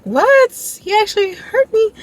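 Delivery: high, squeaky gasp of surprise; stunned mock squeal; shocked disbelief. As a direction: jump way up in pitch, can't believe it. Stunned reaction retold.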